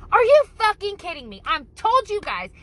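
put-on loud, exasperated shout at the kids; angry yelling mom. Mom yelling at kids about throwing balls, loud exasperated shout. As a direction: blow up at the kids over the ball. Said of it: "that's where you'd hear that".

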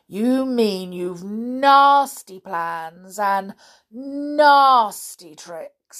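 slow, loud, leaning on "nasty"; indignant elephant's rebuke; scornful accusation. Acted. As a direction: drag out each insult in disgust.

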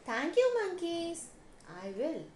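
lightly voiced elephant; a hint of the grateful elephant. A narrator's reading.

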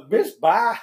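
high, laughing brush-off; mock sassy rejection; dismissive woman's reply. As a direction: wave him off with a laugh. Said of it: "it's like, uh".

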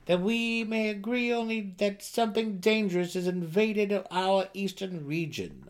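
an uneasy advisor pressing the king; worried gnome counselor. Acted. Another counselor speaks.

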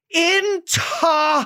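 drawn-out shout of the word, spelled by sound. Retold or imitated. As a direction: yell it slowly like an impatient friend. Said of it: "Og så er hun sådan". (langsomt, højt råbt ord)

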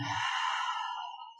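long audible breath out; demonstrated sigh. Shown as a demonstration. Meditation guide shows listeners how to release the breath, sighing out. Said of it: "sigh it out".

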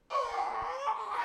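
very high, drawn-out mock cry; hammed-up high squeal; imitated moan. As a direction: wail high, imitating the woman. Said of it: "she's just like".

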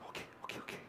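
tiny, barely audible whisper; timid little boy. Acted. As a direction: give in under your breath. Scared boy gives in.

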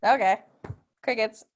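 ordinary talk, nothing marked

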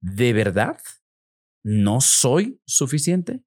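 slow "¿De verdad no soy suficiente?" rising on suficiente; slow incredulous question. Shown as a demonstration. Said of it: "ponle al inicio una palabra".

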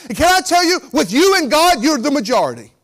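fervent pastor driving it home; full-force preaching. Acted. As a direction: preach it up to the rafters.

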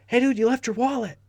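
calling out high to a stranger; hollered quote. Retold or imitated. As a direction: shout it over to the guy on the treadmill. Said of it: "And he said".

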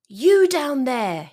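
loud, high, slow call downward; crying out. Shown as a demonstration. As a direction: call out loudly to someone below. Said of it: "he cried".

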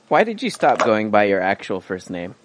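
usual speaking voice

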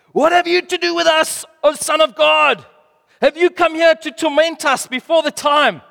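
high, loud snarling demon cry. Acted. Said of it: "You like my demon voice?".

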